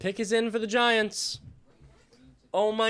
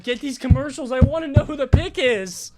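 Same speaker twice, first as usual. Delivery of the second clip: louder, higher impatient demand; impatient outburst; 4 semitones higher, 7 dB louder than usual. Real, not acted. Streamer waiting through commercials, loud impatient outburst. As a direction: push it out, dying to know.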